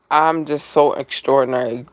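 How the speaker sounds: ordinary delivery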